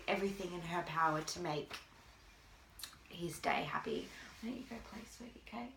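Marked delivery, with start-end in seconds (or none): 4.4-5.7 much softer and higher, tender to her son. Real, not acted. Mother gently sends her son off to play. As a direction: coax him away softly.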